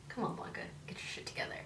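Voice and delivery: soft whispered pep talk to herself; self-scolding whisper. Retold or imitated. Telling herself Blanca to pull together, whispered. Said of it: "you're just like".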